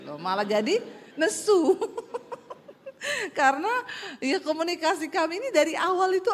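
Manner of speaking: normal talking voice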